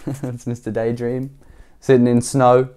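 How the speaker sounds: ordinary delivery, nothing marked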